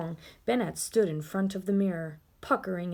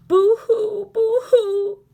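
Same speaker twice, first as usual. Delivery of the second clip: much higher, louder mock crying; mock him with fake sobs; mocking boo-hoo; 12 semitones higher, 10 dB louder, slower than usual. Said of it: "the bat mocked him".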